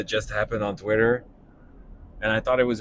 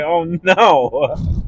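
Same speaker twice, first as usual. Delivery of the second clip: botched Jimmy Stewart; 7 semitones higher, 6 dB louder than usual. Retold or imitated. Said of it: "I was like".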